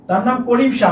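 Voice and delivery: ordinary talk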